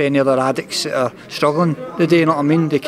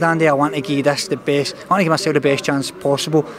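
usual speaking voice

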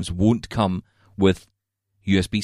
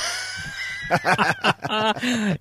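high-pitched, drawn-out laugh; 12 semitones higher than usual. Real, not acted. Host laughing at co-host's excuse to step out, high drawn-out laugh.

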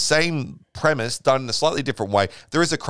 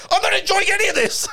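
laughing mock quote. Imitating comic, laughing.

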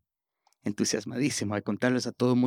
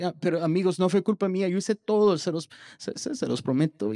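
high, rising frantic plea; desperate pleading; 7 semitones higher than usual. Retold or imitated. Boy pleads with classmates that it wasn't his fault. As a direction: beg them to believe you.

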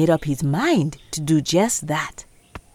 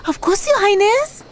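high, bowing hyena; fawning to the queen; 14 semitones higher, 3 dB louder than usual. Acted.

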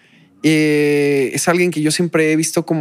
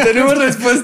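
mock announcer; 7 semitones higher than usual. Teasing TikTok caption quote.

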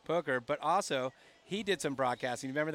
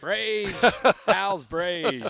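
raised, drawn-out catchphrase impression; louder mimicked catchphrase; imitating colleague's dad; 3 semitones higher, 8 dB louder, much slower than usual. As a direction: imitate an old friend's hallway greeting. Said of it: "or your dad".

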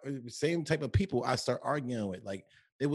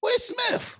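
squeaky where's; 5 dB louder than usual. Real, not acted.

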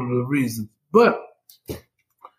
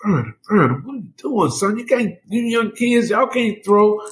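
imitated scolding in a raised pitch; disappointed doctor; 8 semitones higher than usual. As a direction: plead like a doctor let down by a youngster. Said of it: "he was just so disappointed in me".

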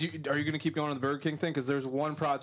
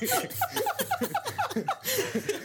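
high-pitched laugh. Real, not acted. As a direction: burst of high cackling laughter. Friend cracks up.

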